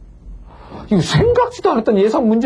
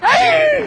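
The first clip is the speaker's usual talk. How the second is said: frustrated outcry; much slower than usual. Retold or imitated. Outburst of frustration.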